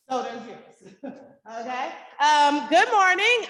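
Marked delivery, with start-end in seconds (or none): 2.2-3.5 teacher voice. Real, not acted. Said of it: "I got my teacher voice going on".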